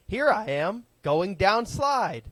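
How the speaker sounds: high, halting robotic narrator voice; animatronic voice. Retold or imitated. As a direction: imitate the dumb TikTok voiceover. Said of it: "animatronic voice that they use".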